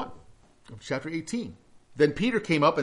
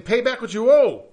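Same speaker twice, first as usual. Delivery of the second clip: louder, higher harsh demand; angry demand; 7 semitones higher, 11 dB louder than usual. Acted. Slave to fellow servant he is choking, angry demand. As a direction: bark the demand at the debtor.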